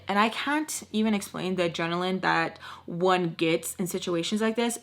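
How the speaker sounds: ordinary talk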